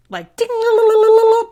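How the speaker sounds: high sing-song ding, la la la; comic superhero tingle sound, loud and high; spidey sense sound. Retold or imitated. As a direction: sing out a high alarm jingle. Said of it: "the official sound of spidey sense".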